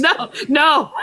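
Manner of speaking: ordinary delivery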